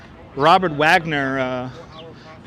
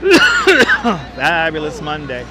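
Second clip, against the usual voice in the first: drawn-out emphasis; much slower than usual. Shown as a demonstration. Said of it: "Fabulous Monday indeed".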